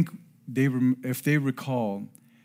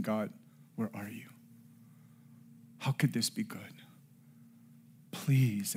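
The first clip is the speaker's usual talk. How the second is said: much softer, broken-down plea to God; tearful prayer; 4 semitones lower, 10 dB quieter than usual. Retold or imitated. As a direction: pray it quietly as if through tears. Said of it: "I remember crying in tears".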